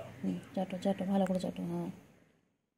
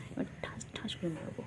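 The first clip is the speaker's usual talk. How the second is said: softer whispered mock threat; 6 dB quieter than usual. Playful threat to pet. (ফিসফিস করে মজার হুমকি)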